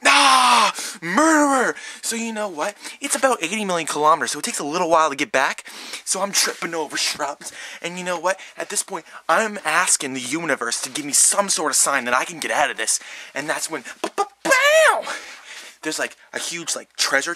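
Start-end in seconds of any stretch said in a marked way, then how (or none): 0.0-1.8 high, loud shouted brush-off; defiant shout. Retold or imitated. Fleeing narrator shouting back at the killer, loud and high. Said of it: "And I'm like". 14.0-15.0 high, slow sung fanfare noise; fanfare sound. Retold or imitated. The universe sends a sign, a high sung fanfare.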